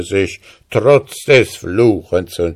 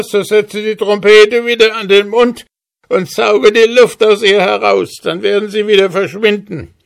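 very high, pleading little dwarf; 13 semitones higher, 4 dB louder than usual. Acted. Dwarf offers the hussar a magic trumpet, squeaky and pleading. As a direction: bargain fast in a squeaky voice.

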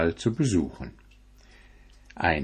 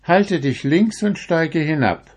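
put-on high, firm old woman voice; 8 semitones higher than usual. A narrator's reading. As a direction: send him off in a high old voice. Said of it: "war die Antwort".

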